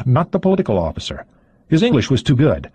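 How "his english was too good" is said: usual speaking voice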